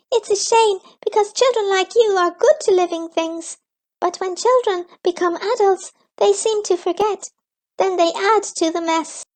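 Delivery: very high, fast little creature; squeaky creature voice. A narrator's reading. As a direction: chirp it out like a tiny forest creature. Another Gleek speaks.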